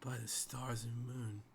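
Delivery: hushed oath of wonder; awed murmur. Pretend. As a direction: breathe it out softly, stunned. Stunned guard marvels at the wizard.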